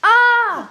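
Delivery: sudden high shriek of surprise; startled scream. Real, not acted. Girl startled by a sudden visitor, shrieking. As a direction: yelp as if caught off guard.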